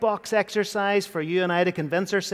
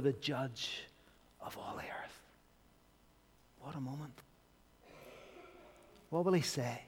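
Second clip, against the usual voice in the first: hushed; 4 semitones lower, 13 dB quieter than usual. Real, not acted. Preacher hushing the room before Jesus speaks. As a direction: let it fall to a hush.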